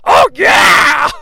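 high excited shout; primal yell. Retold or imitated. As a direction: let out a wild whoop. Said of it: "Tony lets out a primal".

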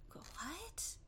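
whispered, high stunned what; mock disbelief. Shoemaker stunned by the worn shoes, whispered disbelief. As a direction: breathe it out in imitated shock.

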